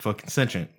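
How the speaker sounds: low, soft "sentient" as SEN-shent. Shown as a demonstration. Insisting on own way, SEN-shent for "sentient". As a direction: say "sentient" in two syllables, softly. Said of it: "this is the way I say it".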